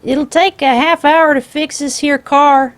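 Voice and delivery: loud high call across the yard. Acted. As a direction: call over from the car. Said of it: "Hiram called".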